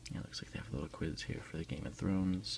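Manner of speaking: ordinary delivery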